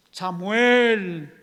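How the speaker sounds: loud, drawn-out name call; booming stretched-out call. Acted. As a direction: summon a sleeper from afar. God calls the sleeping Samuel.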